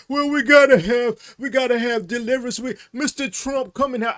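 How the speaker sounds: high-pitched mimicry of desperate believers; mock pleading Christians. Christians begging Trump for deliverance, mock pleading in a high voice. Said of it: "The Christians are gonna say".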